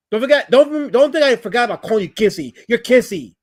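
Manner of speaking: loud, high-pitched heckling back; taunting comeback. Real, not acted. Streamer throwing a nickname back at a chatter, loud taunt. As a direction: fire the nickname back loudly.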